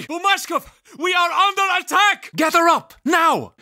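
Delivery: high urgent shout to a comrade; shouting alarm. Acted. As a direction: yell the warning across the fight.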